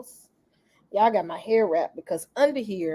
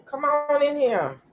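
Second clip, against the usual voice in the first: ordinary talk, nothing marked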